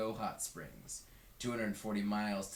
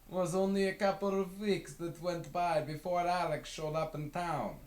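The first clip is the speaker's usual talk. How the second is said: higher, fond voiced man; 9 semitones higher, 4 dB louder than usual. A narrator's reading. Westerberg remembering Alex's return to Carthage, fond. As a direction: recall it with a fond lift. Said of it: "Westerberg remembers".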